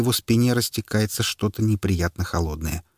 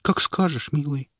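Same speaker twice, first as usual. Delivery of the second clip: meek; 7 semitones higher than usual. Acted. Wife meekly obeys husband.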